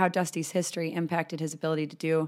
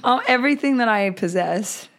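loud and laughing, higher; laughing loudly; 6 semitones higher, 7 dB louder than usual. Real, not acted. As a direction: laugh through the words.

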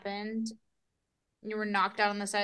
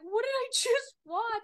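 high, slow, crying horrified question; mock horrified cry; 13 semitones higher, 5 dB louder, slower than usual. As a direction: whimper it out like you just saw a horror film. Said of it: "I was like".

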